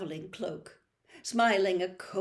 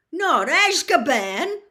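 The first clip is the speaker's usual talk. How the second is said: slow, loud, higher horrified croak; 8 semitones higher, 12 dB louder than usual. Acted. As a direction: Hagrid croaking out his worst fear. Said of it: "croaked Hagrid".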